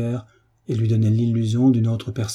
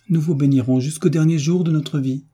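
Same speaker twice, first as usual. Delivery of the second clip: higher, mildly tearful entreaty; throat full of tears; 6 semitones higher, 4 dB louder than usual. A narrator's reading. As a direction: plead as if holding back tears. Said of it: "le gosier plein de larmes".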